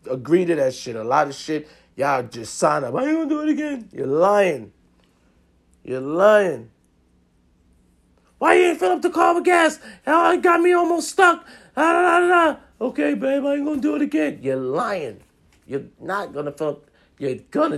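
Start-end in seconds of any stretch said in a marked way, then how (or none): 2.9-3.9 high-pitched mock promise, much higher than usual; placating promise. Mimicking men's empty promise again. 8.4-12.6 loud, very high mock scolding; nagging partner. Acting out angry partner complaining. 12.8-14.4 high-pitched mock promise, much higher than usual; placating promise. Man placating the scolding partner.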